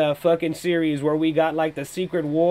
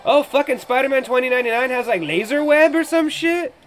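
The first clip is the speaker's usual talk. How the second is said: loud, high, thrilled hype; excited yelling; 9 semitones higher, 7 dB louder than usual. Real, not acted. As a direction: shout in pure fanboy excitement. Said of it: "I got a little too excited there".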